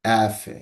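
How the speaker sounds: অ্যাফে said as "eh-feh", stress on the first syllable; teacher answering the letter name; Portuguese letter F. Shown as a demonstration. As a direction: model অ্যাফে clearly. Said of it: "পর্তুগিজে এফটাকে কি বলে?".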